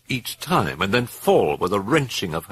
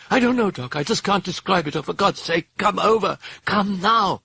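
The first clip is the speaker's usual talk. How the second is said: high, urgent hysterical plea; distraught; 11 semitones higher than usual. A narrator's reading. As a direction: beg for help, high and frantic. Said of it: "Her voice was distraught".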